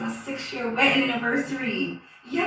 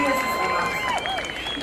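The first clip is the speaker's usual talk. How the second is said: squeaky aside; much slower than usual. Acted. Sudden squeak on phone.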